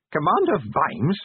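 slow, high-pitched reprimand; snapped. A narrator's reading. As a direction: pull him up short by his title. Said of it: "snapped the inspector".

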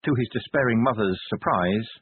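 plain reading voice

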